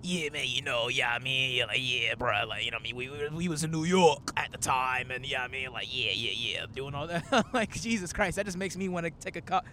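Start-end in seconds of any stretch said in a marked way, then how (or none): 0.0-7.2 raspy rapper impression, like a talking cigar; exaggerated smoke-cured rapper voice. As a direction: rasp through rambling rapper filler. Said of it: "[name] sounds like if Backwoods could talk".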